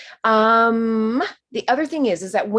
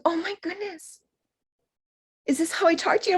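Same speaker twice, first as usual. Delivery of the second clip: tearful awe; 8 semitones higher, faster than usual. Retold or imitated. Retelling tearful first contact.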